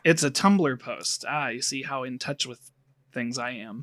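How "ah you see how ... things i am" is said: lightly voiced old geezer, lower and softer; a hint of a grumpy old man. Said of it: "when I started doing the old man voice".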